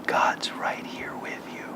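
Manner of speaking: slow, hushed whisper; whispered in the ear. Retold or imitated. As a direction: imitate a friend's slow, reassuring whisper into someone's ear. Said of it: "he just whispered in my ear".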